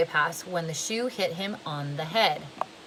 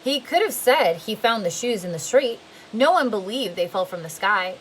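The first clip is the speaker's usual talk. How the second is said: usual speaking voice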